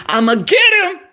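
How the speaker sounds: high, loud put-on vow; gleeful growing determination. Real, not acted. Woman vowing to land her man, gleefully determined. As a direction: belt it out with relish.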